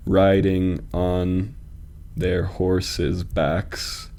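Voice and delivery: slow, measured scansion; metrical, deliberate pacing; syllable-counting reading. Shown as a demonstration. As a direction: draw out each syllable so it can be counted. Testing altered line's beat.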